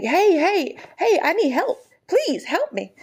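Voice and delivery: high pleading calls with drawn-up peaks; mock-helpless call for attention; calling for help.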